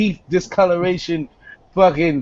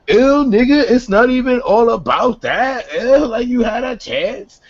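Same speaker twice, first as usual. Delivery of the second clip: mock disgusted girl; 5 semitones higher than usual. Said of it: "it's always like".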